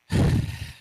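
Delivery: loud unvoiced sigh of exhaustion; a husband's heavy sigh at the request; heavy weary sigh. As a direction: blow out a tired sigh. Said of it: "when you make that sound".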